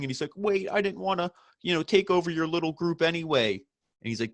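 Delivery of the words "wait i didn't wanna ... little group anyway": high, whiny fake-sob plea, pitched well up; hammed-up whimper of a begging heel; mock crying. MJF begging his old group, mock crying and high. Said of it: "he's, like, gonna start crying".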